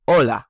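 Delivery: teacher drill of "hola"; repeat after me. Shown as a demonstration. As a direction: model "hola" for the class to echo. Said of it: "repeat after me".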